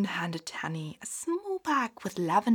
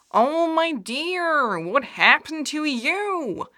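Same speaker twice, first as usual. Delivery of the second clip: tender concern; 8 semitones higher, 8 dB louder, slower than usual. Acted. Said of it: "Bunny asked".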